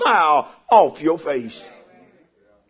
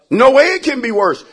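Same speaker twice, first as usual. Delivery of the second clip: ordinary delivery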